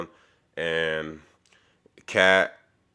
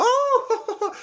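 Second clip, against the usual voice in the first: swooning oh; 4 dB louder than usual. Retold or imitated. Raya swept up, delighted.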